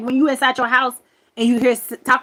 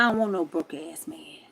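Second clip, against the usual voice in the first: hushed whisper; 5 semitones lower, 5 dB quieter than usual. Retold or imitated. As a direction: whisper it with contempt. Retorting in a whisper.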